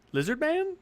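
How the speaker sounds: high, rising incredulous voice; baffled question. Acted. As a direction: query it in disbelief, pitch climbing. Duke baffled by the body.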